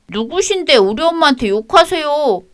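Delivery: high, sulky schoolboy answering back; child's defiant voice. Acted. Grandson snapping at his grandmother, high child voice. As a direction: talk back like a stubborn kid.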